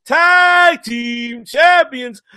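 ringmaster building to a big shout. Pretend. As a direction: drag out the title, rising to a yell. Announcing the champions, building to a shout.

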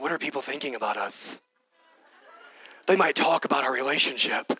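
low, hushed mock worrying; odd put-on worrier voice. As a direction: drop into a silly low voice for imagined self-conscious thoughts. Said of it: "Why am I talking like this?".

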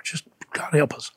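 breathy whisper, sighing the prayer; whispered aside. Real, not acted. Speaker ashamed of his past pride, whispering. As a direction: barely voice it, like a plea under the breath.

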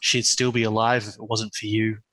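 low, eerie haunting voice; menacing ghostly telepathic voice; creepy voice. Acted. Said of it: "in a creepy voice".

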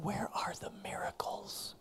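whispered retelling of the question. Retold or imitated. Preacher whispers a friend's doubt to the congregation. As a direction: lean in and whisper it.

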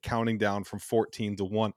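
ordinary talk

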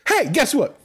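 ordinary talk, nothing marked

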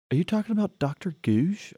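high, disbelieving ask; incredulous question. Retold or imitated. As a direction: suddenly realise who the visitor is. Puck realises who the visitor is.

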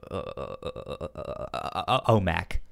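ordinary delivery

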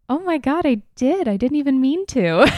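high, loud realization; suddenly thrilled realization; excited surprise. Real, not acted. Realizes accidental pun on patience.

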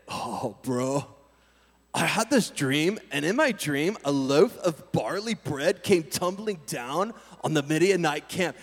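slow, dazed stoner telling a dream; lazy, spaced-out dream recounting; stoner bro. Retold or imitated. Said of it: "sounds like stoner talk".

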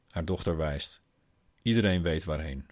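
plain reading voice

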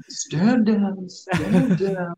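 high-pitched, hammed-up frantic command; mock panicked order. Co-host imitating Hayward with guns on him, mock panic. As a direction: play a rattled commander calling off his men.

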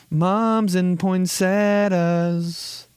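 high, slow stretched phrase; amused drawl. Real, not acted. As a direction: savor each word, amused. Amused exclamation.

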